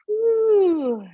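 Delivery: loud high whoop after holding the pose; whoo of effort. Real, not acted.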